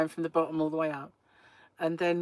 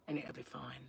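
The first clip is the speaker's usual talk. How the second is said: whisper; 4 semitones lower, 13 dB quieter than usual. Acted. Advising the taxi driver.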